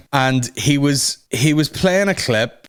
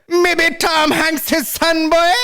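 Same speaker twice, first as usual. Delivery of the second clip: squeaky mock Jamaican boast; slow, high-pitched Jamaican patois; hammed-up Jamaican accent, proudly announcing; Chet Hanks; slower than usual. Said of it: "He's at parties like".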